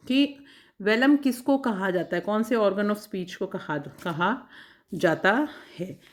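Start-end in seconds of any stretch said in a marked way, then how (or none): none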